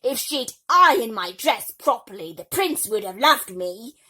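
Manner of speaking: loud spoiled whine. Acted. Bertha continues whining.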